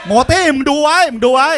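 a loud, high call telling a fellow comic to watch closely; loud callout. Real, not acted. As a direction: shout at Moti to watch.

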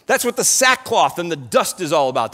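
usual speaking voice

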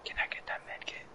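soft unvoiced whisper. Real, not acted. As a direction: keep it to a whisper. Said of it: "Why are you whispering?".

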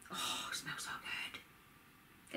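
very slow, hushed swoon over a scent; blissful whisper. Real, not acted. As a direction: breathe it out in a drawn-out delighted whisper. Sniffs the body butter, swooning.

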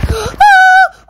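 sudden high loud shriek; real panicked yelp; startled scream. Real, not acted. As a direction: scream as if ambushed. Startled by attack in game.

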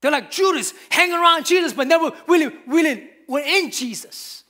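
loud, rising preacher's cry, driving each phrase home; full-force preaching. Acted. Pastor rebuking false believers, in a loud full-force preaching cry. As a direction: preach it hard and loud to the congregation.

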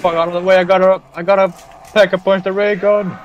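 high, loud frantic yelling; urgent shout. Real, not acted. Player rushing teammates aside to upgrade ray gun, shouting.

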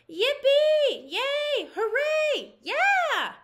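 high, loud excited cheers; a burst of shrill happy classroom whoops; kids cheering. Acted.

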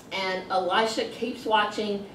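ordinary talk

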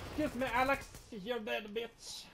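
high loud taunt; gloating yell. Real, not acted. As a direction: crow over the kill. Taunting enemy after kill.

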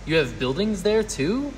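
high, rising incredulous question; gasped astonishment. A narrator's reading. Horton learning the speck has buildings, astonished. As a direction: ask it amazed, pitch climbing. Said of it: "Horton gasped".

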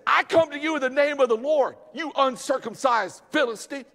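high, loud defiant battle cry; David's bold challenge. Acted. Young David taunting the giant, loud and defiant. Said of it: "He says".

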